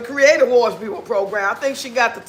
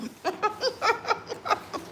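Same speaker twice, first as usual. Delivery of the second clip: trailing high laugh, much softer; 9 semitones higher, 12 dB quieter than usual. Real, not acted. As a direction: let the laugh fade out quietly. Laughing on after the joke.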